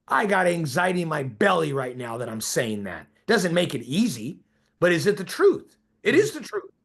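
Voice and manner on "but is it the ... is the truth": rising insistence. Real, not acted. Insisting on the point.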